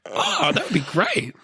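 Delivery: put-on high, slow, sugary child voice; dad mimics his daughter's sweet acceptance, high and slow; imitating delighted daughter. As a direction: act pleased as if it wasn't your idea. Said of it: "She'll be like".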